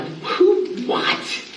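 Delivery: squeaky high startled "who", then a whispered "what?"; mock shocked reaction. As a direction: act appalled by your own old post. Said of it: "I read this and I'm like".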